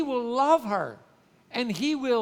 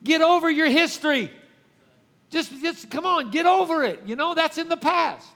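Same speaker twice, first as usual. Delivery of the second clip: raised, louder, dismissive get over it; impatient husband; 7 semitones higher, 7 dB louder than usual. Retold or imitated. Husband loudly telling his wife to get over her history, impatient. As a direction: brush her past aside, loud and impatient. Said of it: "the guy looks at her and says".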